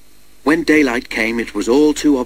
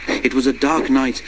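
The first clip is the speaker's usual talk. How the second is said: ordinary delivery, nothing marked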